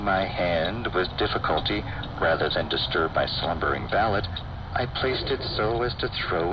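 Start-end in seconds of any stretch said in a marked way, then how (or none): none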